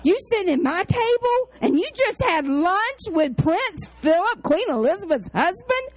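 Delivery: can't believe who's at her table; astonished disbelief. Retold or imitated. Preacher retelling her shock at the trustee's royal lunch, astonished.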